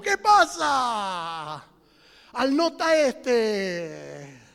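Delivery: very slow, loud Andalusian jeer; Andalusian mockery. Elijah jeering at the silent prophets, slow loud Andalusian. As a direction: stretch it out like a cheeky Andalusian. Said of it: "le diría en andaluz".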